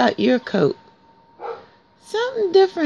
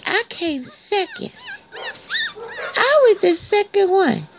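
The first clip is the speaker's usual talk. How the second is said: puppy voice; 10 semitones higher than usual. Pretend.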